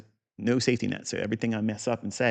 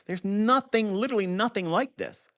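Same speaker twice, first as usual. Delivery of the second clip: emphatic boast; 9 semitones higher than usual. Real, not acted. Trader boasting to viewers, raised and emphatic. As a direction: push "nothing" up high with conviction. Boasting about his method.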